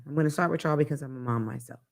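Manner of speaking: normal talking voice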